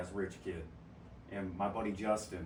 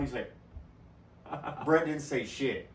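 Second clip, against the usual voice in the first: usual speaking voice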